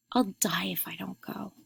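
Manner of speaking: soft, breath-only whisper; hushed secret plea. A narrator's reading. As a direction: whisper it desperately, barely aloud. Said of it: "she whispered".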